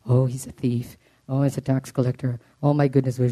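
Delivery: a hint of gossiping critics, sniffing at him; mocking gossip voices. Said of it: "heard a lot of voices".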